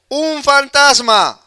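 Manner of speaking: grito de los discípulos. Retold or imitated. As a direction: call it out as a startled crowd would. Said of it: "Y dieron voces". (grito alargado, agudo y fuerte)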